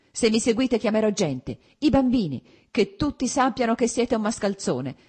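loud, high furious threat; a wife screaming threats at her husband; shouting. A narrator's reading. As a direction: threaten him at full voice. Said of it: "E come gridava".

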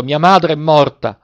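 put-on slow cry of despair from a boy; desperate cry. A narrator's reading. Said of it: "con un altro grido disperato". (grido disperato, lento e acuto)

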